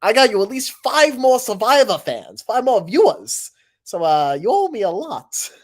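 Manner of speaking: loud eager sales pitch; pitching to Survivor. Retold or imitated. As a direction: sell it big and brash. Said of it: "I'll tell Survivor".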